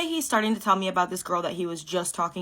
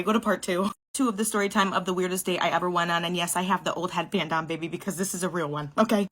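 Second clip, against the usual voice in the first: normal talking voice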